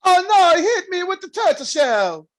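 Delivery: put-on terrible high, loud Italian accent; Mario accent. Co-host joining the bit, loud mock Italian Mario. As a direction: wail it in a cartoon Italian accent. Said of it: "goes into this terrible Italian accent".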